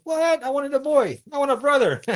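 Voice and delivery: put-on high-pitched protest of a disappointed child; acted-out sibling letdown, pitched high; dismayed child. Child dismayed at baby news.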